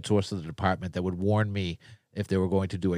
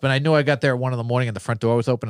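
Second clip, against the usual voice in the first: normal talking voice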